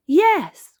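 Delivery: very high, loud yes; delighted cry. A narrator's reading. Child agreeing to a show, crying out delightedly. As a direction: burst out with glee. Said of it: "cried Peppa".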